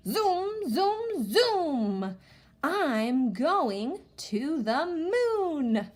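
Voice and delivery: high zooms, then a very slow stretched moon line; excited read-aloud. Shown as a demonstration. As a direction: make the kids hear the fun. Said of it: "All right, here we go".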